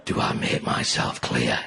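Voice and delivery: very low, slow, quiet gravel threat; dad threatening the kids in the back of the car; Lee Van Cleef menace. Retold or imitated. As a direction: growl it like a spaghetti western villain. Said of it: "You just turned into Lee Van Cleef".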